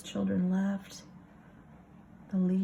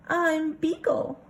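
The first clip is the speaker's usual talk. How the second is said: high, slow cute dog voice; sweet little puppy voice; dog's voice; 10 semitones higher, 5 dB louder, slower than usual. Acted. Beagle introducing himself to Alice, high cute voice.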